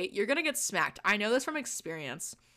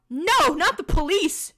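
loud, high, drawn-out cry; hammed-up desperate shout; panicked plea; 8 semitones higher, 7 dB louder, slower than usual. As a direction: beg loudly, stretching the words. Pleading as police are threatened.

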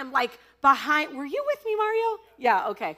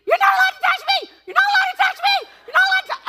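very high, very loud panicked screaming; terrified scream; 8 dB louder, faster than usual. Retold or imitated. Cornered by a chainsaw clown in a haunted house, shrieking in panic. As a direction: shriek it like you're cornered and can't escape.

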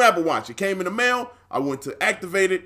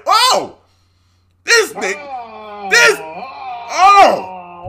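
high, loud drawn-out wail of disbelief; outraged disbelief; 7 semitones higher, 7 dB louder, much slower than usual. Retold or imitated. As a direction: cry out high and stretch it, hammed-up. Reacting to manager's refusal in retelling.

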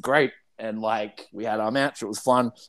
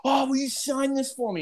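mock eager fan; 11 semitones higher than usual. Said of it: "He's like".

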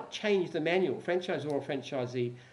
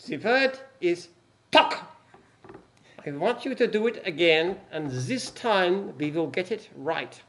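stern German commandant voice; slow menacing German officer; German accent; 3 semitones higher, 4 dB louder, slower than usual. Acted. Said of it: "the accent as well".

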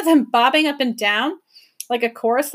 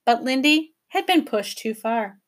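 plain reading voice

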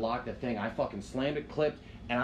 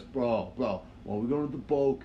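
imitating Big Len. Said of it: "every time he goes".

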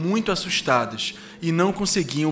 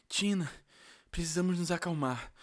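put-on soft, high young boy; quietly steady the girl; hushed boy voice; 3 semitones higher, 10 dB quieter, faster than usual. Guga whispering calm in captivity.

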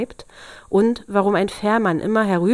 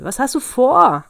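loud, high-rising protest; storybook devil's startled outburst; indignant cry; 6 semitones higher, 7 dB louder than usual. A narrator's reading. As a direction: demand an explanation, voice raised. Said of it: "schrie der Teufel".

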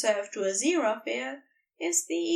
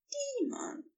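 higher angel voice, much softer; Aziraphale's voice; 9 dB quieter than usual. Acted. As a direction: breathe the word in the angel's voice. Aziraphale voiced, answering Crowley.

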